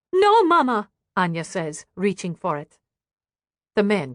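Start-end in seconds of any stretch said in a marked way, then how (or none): 0.1-0.9 put-on high, loud girl's protest; protesting child. A narrator's reading. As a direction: stop her mother sharply. Daughter protests the trinket.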